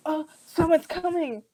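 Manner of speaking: high, loud warning; mock alarm. One Thompson warns the other someone is coming, mock alarm. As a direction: call out a startled heads-up.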